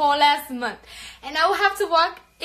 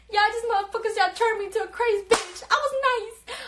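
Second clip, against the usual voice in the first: high-pitched rant; 7 semitones higher than usual. Real, not acted. Ranting at bullies, high pitch.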